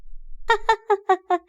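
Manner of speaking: put-on high little-girl giggle; child's giggle. A narrator's reading. Small daughter pleased by daddy's words, giggling.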